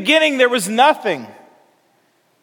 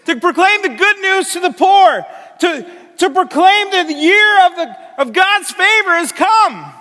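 higher, raised preaching voice; ringing proclamation; 5 semitones higher than usual. Retold or imitated. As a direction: proclaim it to the whole congregation. Jesus proclaims scripture in sermon.

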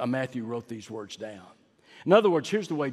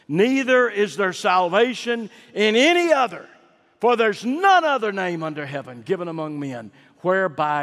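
higher, louder ringing recitation; scripture proclaimed; 6 semitones higher, 8 dB louder than usual. Acted. Preacher quoting Peter's Acts verse, ringing proclamation. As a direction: declaim the verse to the back row. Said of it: "He'd say the same thing he said".

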